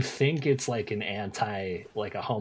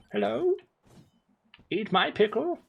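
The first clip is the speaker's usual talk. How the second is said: high, put-on begging peasant; 11 semitones higher than usual. Streamer begging chat as a peasant, high and pleading.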